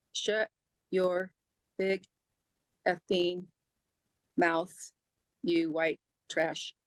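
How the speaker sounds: retold insult, each word set apart by pauses; word-by-word quote. Woman quoting the man who insulted her, word by word. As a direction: quote it slowly and deliberately, pausing between words.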